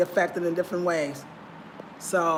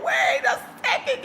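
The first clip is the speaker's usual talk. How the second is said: mock surprised shout. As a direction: shout it like you just caught on. Her own reaction, I was like.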